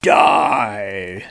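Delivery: low, very slow booming ghost shout; hammed-up spooky ghost bellowing a threat; evil ghost. The evil ghost threatens the heroes, low slow booming shout. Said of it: "the evil, uh, ghost come out and go".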